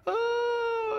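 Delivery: high, drawn-out mock wail; sister's wail. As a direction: wail like an upset little girl. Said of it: "she's going on and on".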